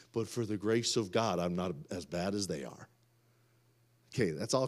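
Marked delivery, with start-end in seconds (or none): 0.1-2.9 low, hushed mock-judging voice; smug condemning aside. Preacher voicing Christians who compare pasts, smug and hushed. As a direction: drop it low, like quietly judging someone.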